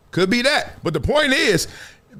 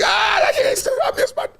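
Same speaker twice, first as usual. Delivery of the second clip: high, hammed-up crying shout for a lost one; mock griever falling out at a funeral, sobbing and shouting; mock funeral wail. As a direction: wail it like a mourner losing it.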